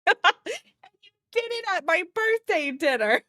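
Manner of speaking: high dramatic voice, laughing; overly dramatic with a laugh. Retold or imitated. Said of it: "It's so, like, dramatic".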